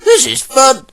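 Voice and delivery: high-pitched talking truck; playful high character voice; excited little truck. Acted. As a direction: pipe up high, having fun. New trucks chatter as characters.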